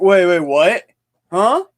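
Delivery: imitated puzzled double take; mock confusion. Friend caught misquoting, mock puzzled. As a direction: play the baffled friend, rising to a huh.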